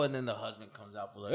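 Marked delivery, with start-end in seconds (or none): none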